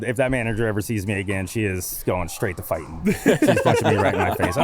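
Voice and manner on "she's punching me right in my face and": laughing. Real, not acted. Laughing about angry manager.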